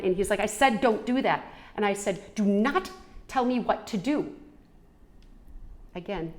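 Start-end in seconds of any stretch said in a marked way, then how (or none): 0.4-1.4 loud higher command; controlling partner repeating an order; stern order. Retold or imitated. Partner orders her, quarrel. 2.3-4.3 loud higher firm refusal; standing her ground against a controlling man; defiant. Retold or imitated. She refuses his order.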